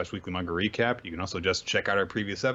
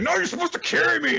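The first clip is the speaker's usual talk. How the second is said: bratty whine; 6 dB louder, faster than usual. Retold or imitated. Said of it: "And she's like".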